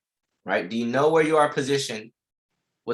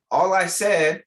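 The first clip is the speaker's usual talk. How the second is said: amused excitement; 5 semitones higher, 7 dB louder than usual. Real, not acted. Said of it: "I felt smart saying that".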